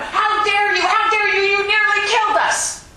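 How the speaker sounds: high, loud furious yelling; angry shout. Acted. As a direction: scream it at the driver. Student yells at cart driver.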